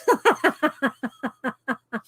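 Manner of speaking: long trailing giggle; laugh. Real, not acted. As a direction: laugh at the joke just read. Laughs after reading joke card.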